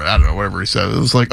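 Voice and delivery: usual speaking voice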